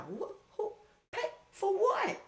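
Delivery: high, slow squeal breaking into a laugh; cracking with disbelief; shocked disbelief. Retold or imitated. Him stunned by the claim he paid her, squealing who pays for what.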